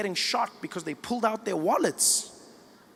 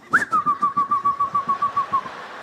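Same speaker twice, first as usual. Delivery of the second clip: high delighted laugh. Retold or imitated. Cop laughing at speed.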